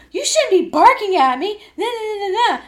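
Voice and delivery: high, loud, taunting coach voice; mocking the coach. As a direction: mimic her nagging, high and loud. Coach scolds her.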